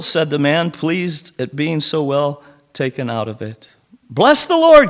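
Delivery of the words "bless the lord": high, loud and joyful; Billy praising God for the help; joyful cry. A narrator's reading. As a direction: burst out in praise. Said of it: "cried Billy".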